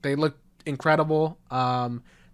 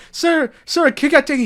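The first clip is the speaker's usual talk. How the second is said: high, louder imitation of a pleading kid; urgent plea; 10 semitones higher, 5 dB louder than usual. Child runs over to some dad, mock-urgent plea. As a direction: play up the child's alarm. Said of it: "a child tries to warn the adults".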